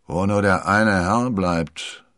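slow, loud grumble; drawn-out, voiced-up mumble; muttering captain. A narrator's reading. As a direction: wonder aloud, dragging the words. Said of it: "murmelte er".

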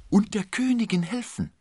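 high, loud delight; eager high-pitched enthusiasm; beaming. A narrator's reading. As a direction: chime in radiantly. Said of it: "strahlte Aramis".

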